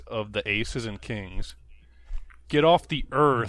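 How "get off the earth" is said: mild mock snarl, a bit louder; hammed-up bully threat. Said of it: "he snarled".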